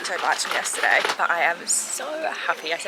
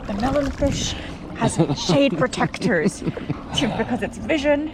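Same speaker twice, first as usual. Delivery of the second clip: nature documentary; 6 semitones higher than usual. Pretend. Mock documentary narration.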